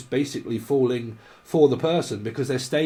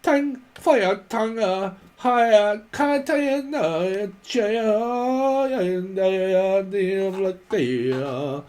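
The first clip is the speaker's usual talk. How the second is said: much higher mock Chinese babble; hammed-up Chinese-sounding voice; imitating Chinese speech, high-pitched; mock Chinese accent; 7 semitones higher, much slower than usual. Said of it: "Someone like Chinese".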